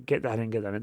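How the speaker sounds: soft, muttered mimicry of girlfriend tucking; imitating girlfriend. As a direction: lightly act out busy tucking under your breath. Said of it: "She was like".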